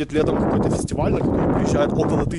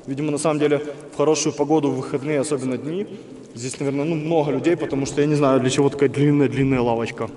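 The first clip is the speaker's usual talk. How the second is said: normal talking voice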